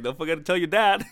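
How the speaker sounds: a joke line pushed through laughter; laughing, half-mock warning; laughing aside. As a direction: say it while cracking up. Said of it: "the first thing I thought about was like".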